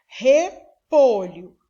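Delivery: "repolho" split into syllables; silabado. Shown as a demonstration. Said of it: "você abre a boca três vezes". ("repolho" dito sílaba por sílaba)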